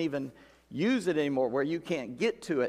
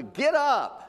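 sharp high call to get up; higher, louder command; God's stern command; 8 semitones higher, 3 dB louder than usual. Acted. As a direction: bark it like a rebuke from above. God rebuking Joshua, voiced.

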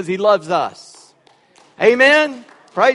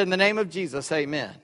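rushed habitual; 4 semitones lower, 7 dB quieter than usual. Retold or imitated. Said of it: "almost habitual".

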